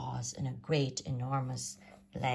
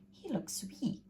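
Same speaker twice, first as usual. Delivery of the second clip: grumbling mother; 8 semitones higher, 6 dB quieter than usual. A narrator's reading. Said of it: "And she grumbled".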